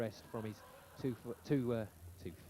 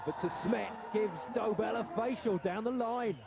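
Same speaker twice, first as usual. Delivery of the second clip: excited shout; 8 semitones higher than usual. Real, not acted. Commentator shouting as De Smet hits Dobell in face. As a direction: yell it as the ball smacks him.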